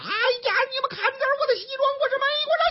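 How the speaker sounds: high, hammed-up whining cry; mock wailing professor. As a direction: whine it out like a spoiled man making a scene. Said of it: "这个嚷嚷啊".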